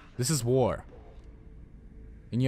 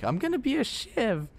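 mock soldier voice. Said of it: "and you were like".

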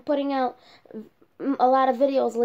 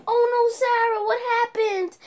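higher, louder worried gasp; alarmed; 9 semitones higher, 3 dB louder than usual. Pretend. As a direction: rush over in alarm. Friend finds hurt doll.

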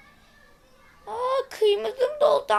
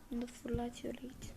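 soft whispered aside; quiet whispered instruction; 7 semitones lower, 21 dB quieter, faster than usual. Real, not acted. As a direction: breathe it out under your breath. Quiet aside while placing it.

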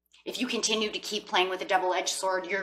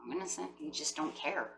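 almost murmured, much softer; quiet aside; 12 dB quieter than usual. Real, not acted. First take, nearly murmured.

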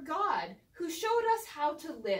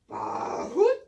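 imitating goat and hoot; 3 semitones lower, 5 dB louder than usual. Pastor copying Minerva's goat sound. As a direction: copy the owl's goat call. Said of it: "did I say it right".

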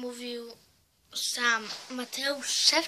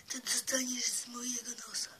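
fast hushed mock threat; teddy whispering a silly threat; whispered threat; 3 semitones lower, 9 dB quieter, faster than usual. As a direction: hiss the warning playfully. Teddy threatening laughing viewer.

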